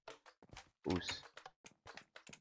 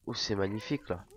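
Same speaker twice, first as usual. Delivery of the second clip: normal talking voice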